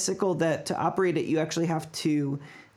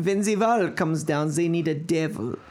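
put-on sexy German accent. Friends tease the accent as sexy, mild German. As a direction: let the German go smooth and sultry. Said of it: "You're a little sexy there".

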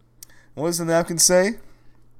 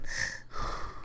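heavy sigh; 11 dB quieter than usual. Acted.

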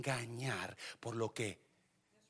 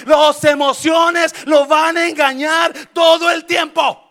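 louder and higher, building to shouted warnings; fiery preaching; 10 semitones higher, 20 dB louder than usual. Acted. Pastor warning the church about emotions, building to a shout. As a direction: preach it at full force to the church.

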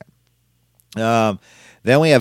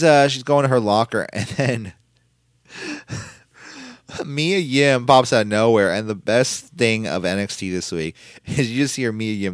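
ordinary talk, nothing marked

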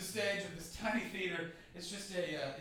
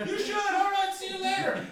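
hurried goodbye; 7 dB louder than usual. Retold or imitated. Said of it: "You're like".

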